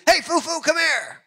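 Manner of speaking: high-pitched call to someone away; storyteller's high summoning call. Retold or imitated. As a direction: act out hailing a man over. Missionary retold calling villager over.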